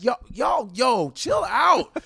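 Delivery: high, drawn-out mock plea; high-voiced comic peacemaker; imitated alarmed intervention. Voices Wanda calming an argument.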